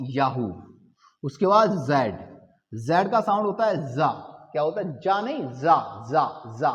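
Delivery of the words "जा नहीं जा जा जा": जा with a buzzing "z", tongue set against the palate, not "j"; जा said as English "za", tongue firm up top; buzzing z sound. Shown as a demonstration. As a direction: hold जा as a voiced "za" buzz. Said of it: "ये तलवा एकदम जम जाता है ऊपर".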